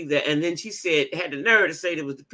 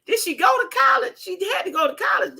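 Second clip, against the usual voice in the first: incredulous shriek; 11 semitones higher than usual. Retold or imitated. Mocking the mayor's schooling to her viewers, shrieking high. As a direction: shriek did she go to college. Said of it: "I was like".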